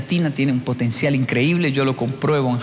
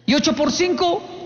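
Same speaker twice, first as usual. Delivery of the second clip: higher, slow taunting question; menacing teacher; 11 semitones higher, slower than usual. Retold or imitated. Maestro probando al niño, slow taunting question. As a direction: drag the trap question out slowly.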